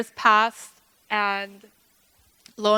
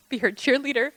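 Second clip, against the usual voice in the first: ordinary talk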